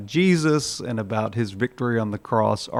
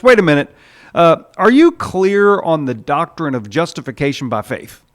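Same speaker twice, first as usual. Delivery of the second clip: higher and louder quizzing; a prim official checking credentials; fussy supervisor angel; 4 semitones higher, 6 dB louder than usual. Retold or imitated. Supervising angel quizzing the thief on doctrine, higher and louder.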